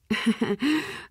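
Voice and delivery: soft little chuckle; amused chuckle. Acted. Player amused at pretending it's for a wedding, soft chuckle. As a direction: chuckle quietly to yourself.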